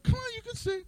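high, soft coaxing back and forth; high hushed coaxing. Retold or imitated. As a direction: plead in a high hush. Coaxing and refusal.

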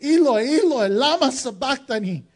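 loud anguished cry to the Father. Acted. Jesus on the cross crying out to God in anguish. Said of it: "he would cry out".

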